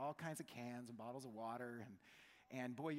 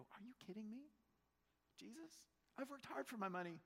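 incredulous protest; 7 semitones higher than usual. Retold or imitated. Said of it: "And you go".

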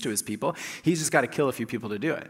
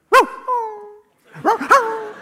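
high, loud mock barking; comic string of barks; imitated dog barks; 8 dB louder, slower than usual. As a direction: yap it out like the dog next door. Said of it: "every time the dog would go".

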